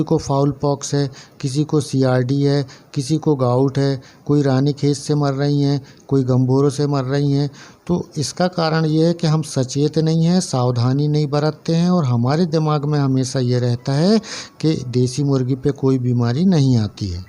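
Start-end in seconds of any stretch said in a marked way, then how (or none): none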